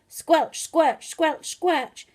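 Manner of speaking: usual speaking voice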